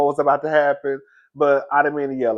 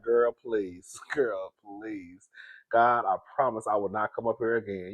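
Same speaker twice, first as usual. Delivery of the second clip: quiet, pleading inner voice, much softer; inner prayer, hushed; 8 semitones lower, 12 dB quieter than usual. Retold or imitated. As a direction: beg God under your breath. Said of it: "girl, thinking like".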